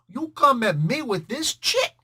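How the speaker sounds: put-on a streetwise New York Italian accent, not clowning around. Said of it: "the New York Italian accent".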